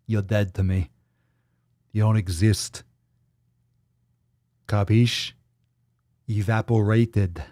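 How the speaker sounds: a loose mock-Italian tough-guy accent; not-quite-Italian accent. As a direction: lightly put on a badly faked Italian mobster accent. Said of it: "that wasn't even an Italian accent".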